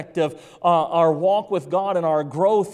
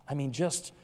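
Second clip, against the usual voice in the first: normal talking voice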